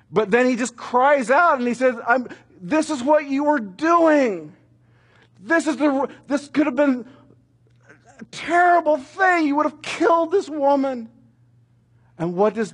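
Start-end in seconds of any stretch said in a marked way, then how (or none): 0.1-11.1 put-on boy crying out. Boy shouting at the drunk pharmacist, high and desperate. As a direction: pitch it way up and cry out at him.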